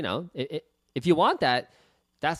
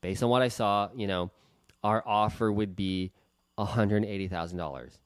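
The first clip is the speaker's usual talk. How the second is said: apologetic lowball; 5 semitones lower than usual. Shown as a demonstration. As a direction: shrink away from the offer as if embarrassed by it. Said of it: "that's the phone call sound like".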